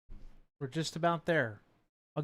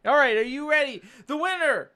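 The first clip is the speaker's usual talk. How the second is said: higher, louder winner reveal; excited announcement; 9 semitones higher, 6 dB louder than usual. Real, not acted.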